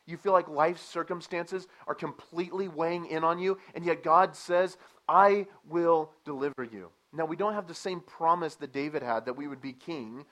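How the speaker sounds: loud, high sermon delivery, slowing on the promise; preaching. Acted. Preacher assuring the congregation of God's promise, loud. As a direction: build to God's promise and let it land.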